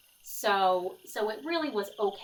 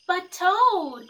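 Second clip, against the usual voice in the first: higher, louder plea; cried out; 8 semitones higher, 7 dB louder than usual. Acted. Frog crying out to Toad under the covers, a loud high plea. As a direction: Frog crying out to wake his friend. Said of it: "cried Frog".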